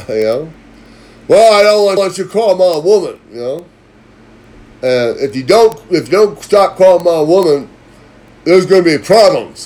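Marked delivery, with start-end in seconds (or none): 1.3-9.7 much louder, higher mock menacing warning; imitating the boyfriend's threat. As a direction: play the dim boyfriend laying down a threat. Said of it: "He sounds like he has a third grade education".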